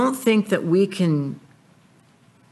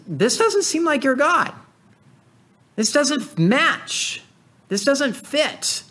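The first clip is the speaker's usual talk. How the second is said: much higher, slow, pleading woman's voice; distraught widow; 11 semitones higher, slower than usual. Retold or imitated. Widow confronting the prophet over her dead son, high and pleading. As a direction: voice the grieving mother, lifted high and slowed. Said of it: "And she goes to the prophet and says".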